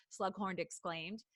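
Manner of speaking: usual speaking voice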